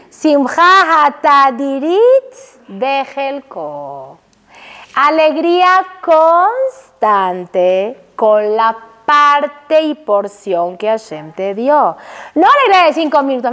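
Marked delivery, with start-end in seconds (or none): 0.1-12.0 low, very slow intoning; solemn teaching. Acted. Teacher explaining constant joy, slow and solemn. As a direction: lay each word down gently.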